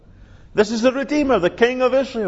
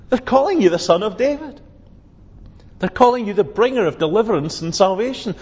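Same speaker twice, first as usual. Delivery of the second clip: normal talking voice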